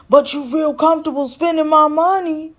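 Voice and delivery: high, loud indignant complaint; mock frustrated yell. Recapper voices Dante arguing with Nicole, mock yell. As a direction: imitate a man yelling his grievance. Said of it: "Dante is outside screaming".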